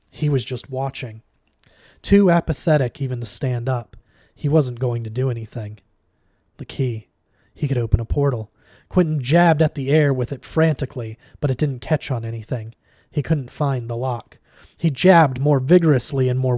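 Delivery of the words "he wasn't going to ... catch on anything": ordinary delivery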